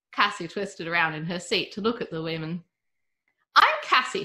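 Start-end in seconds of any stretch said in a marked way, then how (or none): 3.5-4.2 put-on high, bright child's voice, a bit louder; a young girl introducing herself; little girl. A narrator's reading. Cassie introduces herself to the old lady, child's voice. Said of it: "she said".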